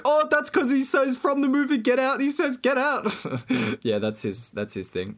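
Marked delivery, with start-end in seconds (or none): 0.0-3.1 very high, loud, overexcited mimicry; hammed-up high-pitched fan voice; mock excitement. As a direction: squeal it like a thrilled movie-goer spotting the title. Mimics everyone latching onto the line.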